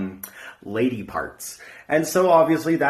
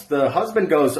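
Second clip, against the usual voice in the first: normal talking voice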